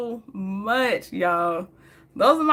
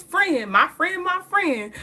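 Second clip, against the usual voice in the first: friend, my friend, drawn out high; slow, sing-song retelling of the friend; high slow imitation of friend; 7 semitones higher, slower than usual. Friend's repeated reply retold.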